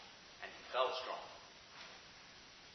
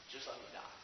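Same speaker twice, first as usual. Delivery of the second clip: whispered and much softer; desperate whisper; 6 semitones lower, 17 dB quieter than usual. Acted. Elijah begging God to let him die, in a soft whisper. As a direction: breathe it out as a weary plea. Elijah prays under the tree.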